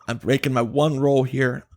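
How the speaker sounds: normal talking voice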